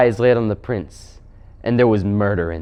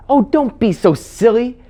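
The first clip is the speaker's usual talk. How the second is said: much higher, louder exasperated youngster; Scrubb; 13 semitones higher, 5 dB louder than usual. Acted. Scrubb telling the Queen off, exasperated. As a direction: scoff in a high child's voice.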